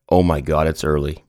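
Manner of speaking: ordinary delivery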